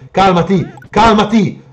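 high, loud plea to calm down; rising, frantic exclamation; panicked command. Real, not acted. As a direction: cry it out at an attacker. Yelling at attacking enemy.

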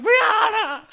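very high, loud mock shriek; retold scream-crying. Friend fallen into thorns, mock scream-crying. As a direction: scream the name in comic despair. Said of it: "I started scream crying your name".

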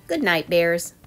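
usual speaking voice

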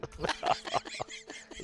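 breathy laugh through pain; laughing while it hurts. Real, not acted. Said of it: "Es tut weh". (schmerzhaftes Lachen)

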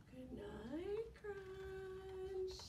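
soft, hushed good night; calm, soothing. Shown as a demonstration. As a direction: lull him to sleep. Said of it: "in a calm, soothing voice".

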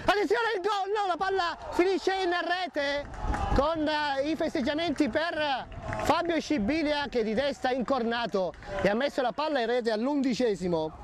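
high, rising goal commentary shout; excited goal call. Real, not acted. Goal scored in football match.

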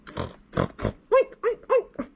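squealing pig oinks; oinking pig. Acted. Pig asking the librarian, oinking. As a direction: oink like a pig.

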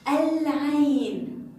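"العين" loud and very slow, ل held; pronounced lam. Shown as a demonstration. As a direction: stretch العين high, L clearly before the ع. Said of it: "حرف العين من حروف اللام".

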